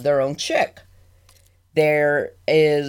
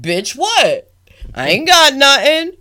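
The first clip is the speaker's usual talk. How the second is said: mock indignant penguin; 8 semitones higher, 4 dB louder than usual.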